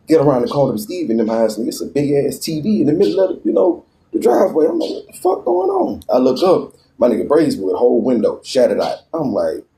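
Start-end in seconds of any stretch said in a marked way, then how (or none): 4.9-6.0 high, slow disbelieving exclamation; baffled, pitched far up and drawn out; stunned. Retold or imitated. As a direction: stretch it out in shock, way up high. Sees TV in the driveway.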